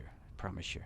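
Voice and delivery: repeated as a soft whisper. Shown as a demonstration. To the livestream viewers, a whispered repeat of the promise. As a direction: drop to a breathy whisper. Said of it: "Sorry, I'm not gonna sing".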